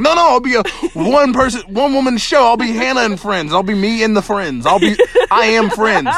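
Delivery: hammed-up self-assurance; mock overconfident. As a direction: imitate a friend insisting they can do it all. Acting out friend's overconfident insistence.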